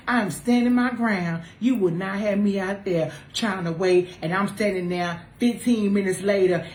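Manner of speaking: ordinary delivery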